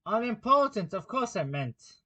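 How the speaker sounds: Unimportant high, then hurried; hasty correction. A narrator's reading. King hastily takes back his word. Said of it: "the king hastily said".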